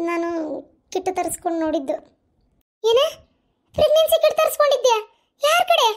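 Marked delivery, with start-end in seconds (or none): 2.8-5.9 loud, high, scandalised questioning; shocked outburst. Acted. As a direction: demand an answer in alarm. Mother shocked at pregnancy kit errand. (ಆಘಾತದಿಂದ ಜೋರಾಗಿ ಪ್ರಶ್ನಿಸುವುದು)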